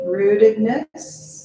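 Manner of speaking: ordinary talk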